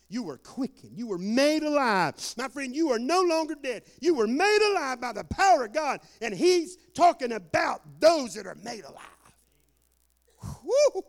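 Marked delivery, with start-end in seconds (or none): 1.0-9.0 loud, high, driving proclamation; fervent preaching. Real, not acted. Preacher telling believers they are made alive, fervent and loud. 10.6-11.0 high, loud, sudden whoop; exultant whoop. Real, not acted. Preacher overjoyed after his climax, exultant whoop.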